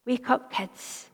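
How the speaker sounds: put-on soft, slow call to wake the children; gentle waking. Father softly waking his children in the car, gentle. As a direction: coax them awake tenderly.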